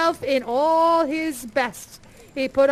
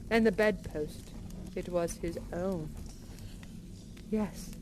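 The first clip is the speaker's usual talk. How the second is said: hushed narration; 4 semitones lower, 9 dB quieter than usual. A narrator's reading. Hushed narration of phantom shrinking.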